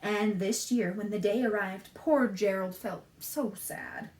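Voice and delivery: lilting rhymed storybook read; reading to children. A narrator's reading. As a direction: read the rhymes to little listeners. Reads picture book aloud.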